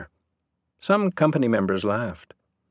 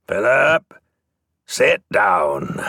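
louder, firm call across the company; 5 dB louder, much slower than usual. A narrator's reading. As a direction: call out a sharp order, louder. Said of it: "Tyrone Mulligan called out".

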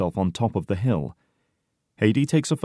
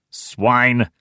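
loud, raised single-word insult; angry snarl; 4 semitones higher, 5 dB louder than usual. A narrator's reading. Heidi insults his mocking comrades, angry snarl. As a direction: spit out the insult angrily. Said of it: "snarls Heidi angrily".

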